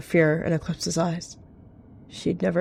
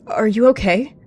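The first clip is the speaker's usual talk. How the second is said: higher, louder worried question; alarmed concern; 6 semitones higher, 7 dB louder than usual. A narrator's reading. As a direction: check on them in sudden worry. Cat alarmed at sick companion.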